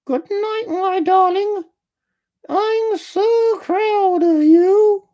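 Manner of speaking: very high, slow, precise woman's voice; high crisp voice with a popped P on proud; ex-wife voice. A narrator's reading. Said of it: "pronouncing the P in proud with cashion and precision".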